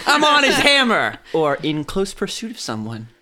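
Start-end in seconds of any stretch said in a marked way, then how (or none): 0.1-1.2 laughing. Real, not acted. Reading Australian slang aloud.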